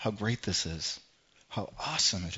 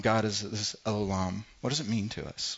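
ordinary talk, nothing marked

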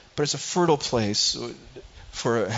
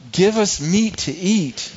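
slow, loud mock wail of the people; imitating the people's wail; 6 semitones higher, 6 dB louder, slower than usual. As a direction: drag it out like a whining crowd. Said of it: "They keep wailing to me".

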